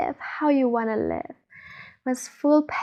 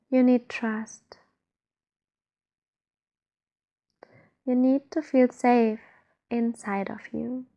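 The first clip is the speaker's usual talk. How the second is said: guided meditation. Acted. Guide leads listeners to feel trust inside, guided meditation. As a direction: ease the listener inward, unhurried.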